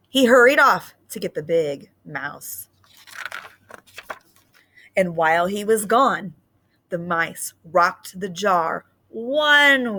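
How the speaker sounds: put-on lively read-aloud for young children; story reading. Teacher reading a picture book to students, lively storytelling.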